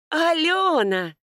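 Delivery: high, drawn-out call; mother calling out. A narrator's reading. Mother spots Алёна coming to the house, calling out. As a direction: sing out the name from afar, long and high. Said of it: "Окрик мамы".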